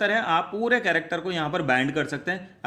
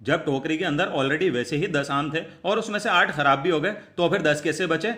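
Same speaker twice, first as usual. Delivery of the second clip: ordinary talk, nothing marked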